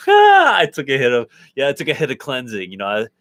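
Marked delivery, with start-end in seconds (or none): 0.0-1.3 laughing through the words. Real, not acted. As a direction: say it while laughing. Laughing at friend's teasing remark.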